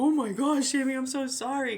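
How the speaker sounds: high, loud, horrified apology; sudden loud apology after spilling on the computer; apologetic gasp. Pretend. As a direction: gasp it out, truly sorry and high. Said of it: "I would have been like".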